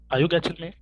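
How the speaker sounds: normal talking voice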